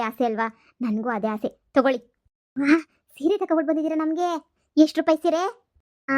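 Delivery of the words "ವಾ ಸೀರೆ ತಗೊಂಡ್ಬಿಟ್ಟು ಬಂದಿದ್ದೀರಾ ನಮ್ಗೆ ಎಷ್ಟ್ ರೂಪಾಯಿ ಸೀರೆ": high, louder wow at a gift; marvel, then ask eagerly; impressed, rising question; delighted surprise. Acted.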